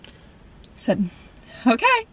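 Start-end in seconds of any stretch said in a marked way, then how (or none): 1.7-2.1 a much higher, rising okay; squeaky resigned okay. Retold or imitated. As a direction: give in with a tiny squeak. Resigned reply to God.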